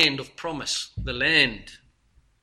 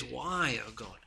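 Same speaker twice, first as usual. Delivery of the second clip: hushed lament; 3 semitones lower, 12 dB quieter, slower than usual. Acted. Psalmist's lament quoted.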